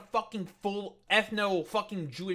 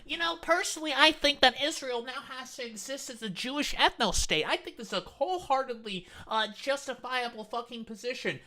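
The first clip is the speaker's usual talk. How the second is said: mock quote; 4 semitones higher than usual. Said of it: "So you're saying, like".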